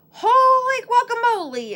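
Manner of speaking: high, loud startled cry; surprised exclamation. Acted. Kitten startled by his reflection in the mirror, loud high exclamation. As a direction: burst out in shock at the mirror. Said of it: "exclaimed Skippy John Jones".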